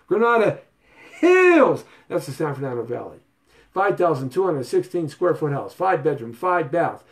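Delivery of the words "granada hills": Granada, pause, then Hills leaps very high and loud; a big dramatic pause before Hills; announcer style. Shown as a demonstration. Showing viewers the right way to say Granada Hills, dramatic pause. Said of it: "You gotta say it like that".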